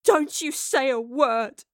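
slow, hurt, forceful wail. A narrator's reading. Astrid to the professor who betrayed her, wailing. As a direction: forbid her to speak, wailing slowly. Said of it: "Astrid wailed".

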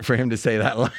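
ordinary delivery

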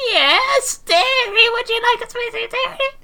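very high, loud granny squeak; Granny Pengus voice. Acted. Granny answers the editor's call, very high and sweet. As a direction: squeal it sweetly like a tiny old lady.

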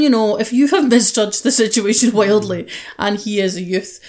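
normal talking voice

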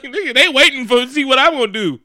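high-pitched, laughing incredulity; comic pleading up high; exasperated protest. Retold or imitated. Rick telling the fugitive police are watching, exasperated and high.

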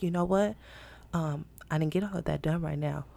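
soft, low murmur at the desk; hushed, discreet office voice; quiet desk voice. Shown as a demonstration. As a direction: keep it low so coworkers don't hear. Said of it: "if I'm talking to you".

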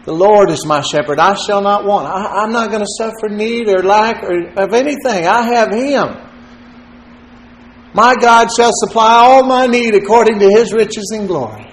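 rising, lifted psalm reading; preaching a psalm. Acted. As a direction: lift the congregation with each line. Reciting and expounding Psalm 23.

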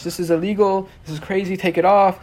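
half-shouted retelling of a scolding; retold yelling. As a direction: imitate an outraged cop ordering someone. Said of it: "He starts yelling at me in French".

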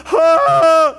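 very high, loud mock bawl; imitated loud crying wail. Pastor imitating grieving seatmate's crying, loud wail. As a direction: wail out one long hammed-up sob. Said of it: "like that kind of crying".

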